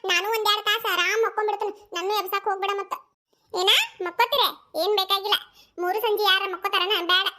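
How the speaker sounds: ordinary talk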